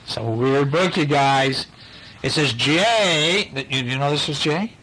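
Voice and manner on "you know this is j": plain reading voice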